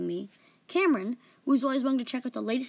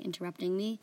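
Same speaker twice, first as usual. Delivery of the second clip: usual speaking voice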